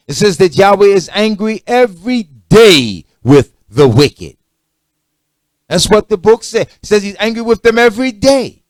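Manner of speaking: loud, high, hammering sermon voice; full-force pulpit preaching about divine anger; fiery preaching. Acted. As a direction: preach it out, rising and pounding.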